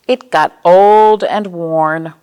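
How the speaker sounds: put-on old and worn, drawn out and emphatic; slow storybook refrain. A narrator's reading. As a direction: stretch each word out for the kids. Refrain read to children.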